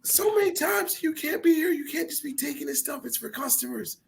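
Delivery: high-pitched mock scolding old lady; imitating a shrill store lady laying down the law. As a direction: wag your finger in a squeaky old voice.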